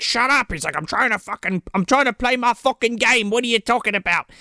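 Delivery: put-on irate Brit, accent sliding into Australian; badly faked British-Australian accent, mock-annoyed; Australian-tinged British yelling. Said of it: "like, yelling at him".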